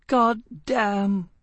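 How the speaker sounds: heart-rending cry. A narrator's reading. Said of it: "in a heart-rending descending note".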